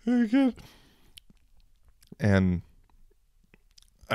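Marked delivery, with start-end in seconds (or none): 0.1-0.6 high whiny imitation of crying mom; mock-tearful mother, much higher; upset mom voice. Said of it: "the mom crying and saying".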